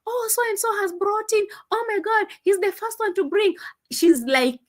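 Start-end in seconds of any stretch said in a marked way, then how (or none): 0.1-3.5 high, eager announcing of the first donor; imitating the sister's hype. Sister bragging to fans about the first donor, high and eager. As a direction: play up her breathless bragging about donors. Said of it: "coming out to tell us".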